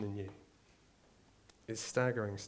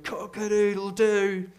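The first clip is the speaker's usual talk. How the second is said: accusing rooster; 7 semitones higher, 9 dB louder than usual. Retold or imitated. Rooster accusing the failed disciple every morning, loud high crowing. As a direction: crow the accusations like a mocking rooster. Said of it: "a rooster that every morning ... is gonna be going".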